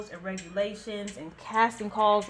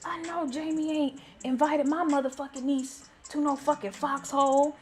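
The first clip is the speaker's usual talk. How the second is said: higher-pitched retold indignation; Dave; 5 semitones higher than usual. Dave hearing about the Foxhole invite, mock mad. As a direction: imitate a mildly worked-up, mad family friend. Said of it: "Oh, he was so mad".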